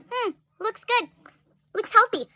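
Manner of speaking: high, offhand mock of a careless doctor. Retelling the doctor's brush-off to viewers, mocking imitation. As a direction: mimic his lazy verdict scornfully. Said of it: "for you to say".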